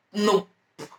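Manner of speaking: normal talking voice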